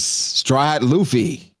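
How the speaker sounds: high, drawn-out greeting of a long-awaited guest; dramatic character greeting. Retold or imitated. Voicing a stranger greeting Luffy.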